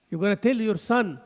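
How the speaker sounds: normal talking voice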